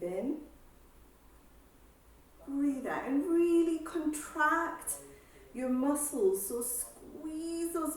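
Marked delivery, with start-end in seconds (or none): none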